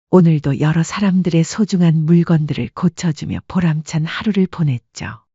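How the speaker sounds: plain reading voice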